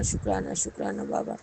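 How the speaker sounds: whispered, breathy repeat of thanks; a hushed whispered thank-you. Real, not acted. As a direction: whisper the thanks devoutly to Baba. Prayer of thanks to Baba.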